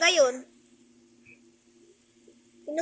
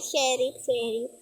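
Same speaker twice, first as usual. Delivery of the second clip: usual speaking voice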